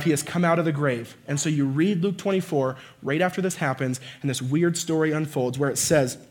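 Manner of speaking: normal talking voice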